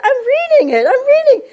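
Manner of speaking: high and loud, fast and breathless, half laughing; excited. Retold or imitated. Flustered, telling the yogi she's just reading, excitedly. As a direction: blurt it out, overwhelmed and thrilled. Retold eager answer.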